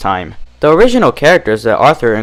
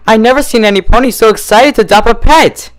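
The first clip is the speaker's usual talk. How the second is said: Fluttershy voice; 11 semitones higher, 4 dB louder than usual. Acted. Fluttershy after Red Tape faints.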